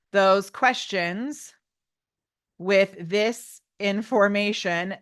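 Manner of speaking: slow, halting words with long gaps; drawn-out dictation to yourself, pausing between words; typing aloud. Real, not acted. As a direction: say it piece by piece as you type. Dictating to herself while typing.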